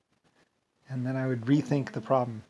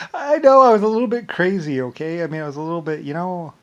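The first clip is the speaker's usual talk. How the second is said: higher, louder sneering mock of critics; mocking impression; 4 semitones higher, 10 dB louder than usual. Mimicking people who call him crazy, higher and louder. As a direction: scoff at yourself as the doubters would. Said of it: "Many people would be like".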